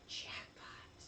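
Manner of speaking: breathless whisper of delight; whispered jackpot. Retold or imitated. Baby finds a cave of eggs, whispered glee. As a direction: whisper it like you found treasure. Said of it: "he's like".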